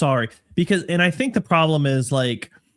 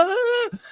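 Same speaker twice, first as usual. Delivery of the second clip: sudden high-pitched yelp of overload; mock shriek of frustration; frustrated yelp. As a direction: squeal like your brain just short-circuited. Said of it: "and I'm like".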